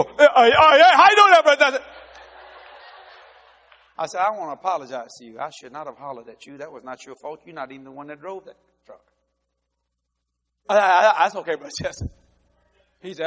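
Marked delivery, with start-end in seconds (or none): 0.0-1.8 much higher, louder stammer; stammering nervous worker. Retold or imitated. Nervous employee greeting his angry boss, high stammer. 4.2-9.0 soft, humble apology; sincere apology. Retold or imitated. Boss humbly apologizing to his worker, softly. 10.7-12.1 nervous stammer. Retold or imitated. Jittery worker forgiving his boss, stammering.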